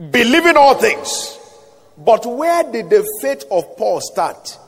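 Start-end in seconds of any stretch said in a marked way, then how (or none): 0.1-1.4 high, loud preacher's exclamation; emphatic repetition. Real, not acted. Preacher repeating scripture to congregation, loud emphatic cry.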